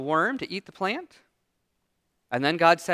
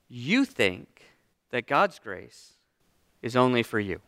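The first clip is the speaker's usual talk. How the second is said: slow God voice; 8 semitones lower, slower than usual. Acted. God's reply voiced.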